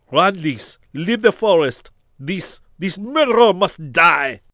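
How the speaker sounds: high, loud frantic yell; desperate shout. Acted. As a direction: shout the order, then the threat. Barreau lunges, orders Lys away.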